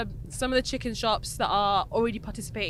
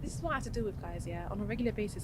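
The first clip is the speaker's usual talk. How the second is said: whispering; 2 semitones lower, 10 dB quieter, faster than usual. Real, not acted. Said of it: "Why are you whispering into the mic?".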